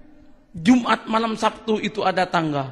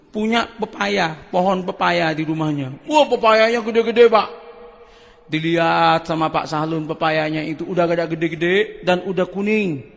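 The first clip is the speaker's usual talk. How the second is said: ordinary talk, nothing marked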